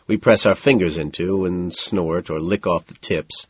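plain reading voice

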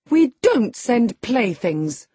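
put-on indignant girl correcting her nurse; proud retort. A narrator's reading. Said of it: "her pride overcoming her shame".

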